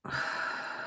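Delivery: soft, breathy blown-out sigh; demonstrated calming out-breath; long exhale. Shown as a demonstration. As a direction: let the breath go slowly and quietly. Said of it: "And blow it out".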